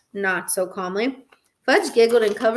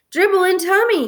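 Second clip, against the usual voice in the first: put-on high, louder toddler voice; little brother; 9 semitones higher, 5 dB louder than usual. A narrator's reading. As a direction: repeat it like an insistent toddler. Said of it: "he repeated".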